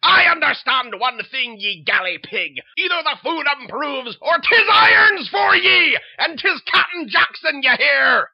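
loud, harsh pirate-captain roar; bellowing captain. Acted. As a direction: bark orders like a tyrant at sea. Threatening captain.

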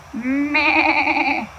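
a bleating meh; goat bleat. Acted. Goat answering Duck with a bleat. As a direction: give a goat's bleat.

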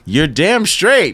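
loud, high, drawn-out affirmation; big emphatic retold reply; emphatic agreement. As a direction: retell it as a triumphant, slow exclamation.

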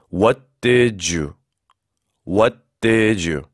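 "What did you" slowly, "did you" run into "di-ju"; linked d-y as ju. Shown as a demonstration. Teacher drilling "What did you", d and y merged into a j sound. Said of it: "한국말 주라고 생각하시면 돼요".

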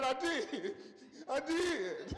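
laughing through the words; laughing boy. Retold or imitated. Boy admitting to his mom he laughed, giggling. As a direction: giggle while confessing.

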